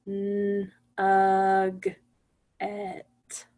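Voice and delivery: nugget pulled apart into N, uh, g, et; sound by sound. Shown as a demonstration. Teacher counting five sounds, each one isolated. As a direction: hold N and uh evenly, then short g and et. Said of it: "I hear five sounds".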